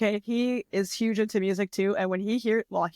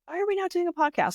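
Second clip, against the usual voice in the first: excited question; 9 semitones higher, faster than usual. Retold or imitated. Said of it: "and I was like".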